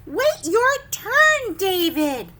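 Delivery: teacher scolding a line-cutter, loud and slow; stern scolding. Acted. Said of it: "he butted in line".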